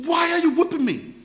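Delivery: indignant cry. Retold or imitated. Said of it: "I said".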